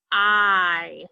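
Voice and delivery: "I" held long and steady; long I sound. Shown as a demonstration. Teacher giving the vowel sound, held long. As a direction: draw out the vowel "I". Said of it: "the long I sound".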